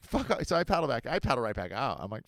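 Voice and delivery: ordinary talk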